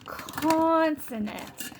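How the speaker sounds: put-on consonants stretched out slowly for the child; drawn-out model word. Shown as a demonstration. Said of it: "Can you say that word for me?".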